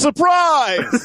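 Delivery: very high, loud announcer shout; put-on announcer voice. Host cutting in to announce the category, shouted announcer voice. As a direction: burst in with a hammed-up shriek. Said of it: "do it in that voice".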